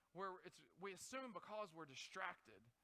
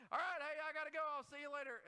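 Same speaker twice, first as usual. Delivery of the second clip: higher, louder hurried goodbye; breezy goodbye; 6 semitones higher, 5 dB louder than usual. Retold or imitated. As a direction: rush out the door cheerfully. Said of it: "then it's like".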